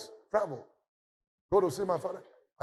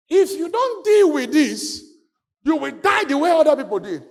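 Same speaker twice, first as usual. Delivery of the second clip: a commanding divine voice, loud and high; voice of God; 7 semitones higher, 6 dB louder than usual. Retold or imitated. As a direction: proclaim it as God speaking. God's voice quoted in sermon.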